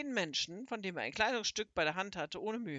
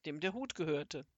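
usual speaking voice

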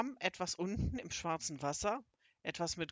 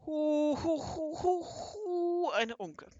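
high, hammed-up boo-hoo wailing; storybook crying, long high hoots; bitter weeping; 10 semitones higher, 5 dB louder, much slower than usual. A narrator's reading. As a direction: howl in theatrical misery, then ease into words. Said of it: "Die verzauberte Fee weinte bitterlich".

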